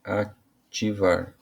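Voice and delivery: REATIVAR very slowly as re... a... tivar; prefix split off. Shown as a demonstration. Teacher separating the prefix, slow broken-up reativar. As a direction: sound out "reativar" with long pauses after re and a. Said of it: "ele separa ali o, o prefixo".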